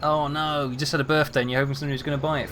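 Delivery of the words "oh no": normal talking voice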